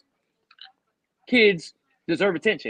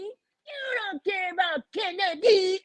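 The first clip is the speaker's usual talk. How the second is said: high, drawn-out wailing imitation; mock hysterical yell; 12 semitones higher, 4 dB louder, slower than usual. Man mimics baby mother accusing him, mock hysterical yell. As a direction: imitate her in a slow, tearful shriek. Retold outburst from his child's mother.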